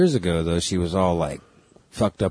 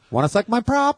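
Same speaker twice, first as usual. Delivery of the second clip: high squeaky toy voice; like a talking doll's voice box; pull string toy; 11 semitones higher than usual. Retold or imitated. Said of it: "You pull it again, it says".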